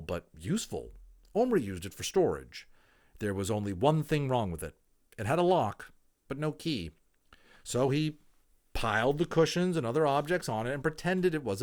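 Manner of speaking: put-on clear, lively reading of a children's book; expressive storybook narration; story narration. A narrator's reading. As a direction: read it aloud like a storyteller to listeners. Reads a children's novel aloud.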